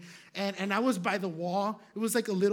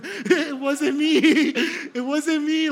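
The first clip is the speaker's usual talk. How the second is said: loud, high plea through laughter; laughing protest; 7 semitones higher, 11 dB louder, slower than usual. Retold or imitated. Accused man tells the coffee shop it wasn't him, laughing protest. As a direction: mock-desperate denial, cracking up.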